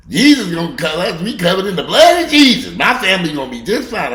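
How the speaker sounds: high loud preachy mockery; loud mock of a churchgoer claiming protection; mocking believer. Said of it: "I've heard this from somebody".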